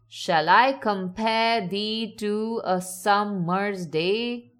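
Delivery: "Shall I compare thee to a summer's day" read slowly, beat by beat; measured iambic stresses through "Shall I compare thee to a summer's day"; metrical verse reading. Shown as a demonstration. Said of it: "some words are naturally emphasized".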